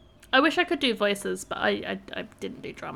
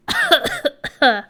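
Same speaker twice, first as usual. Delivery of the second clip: throat-clearing cough; 9 dB louder than usual. Real, not acted. Clears throat before going on.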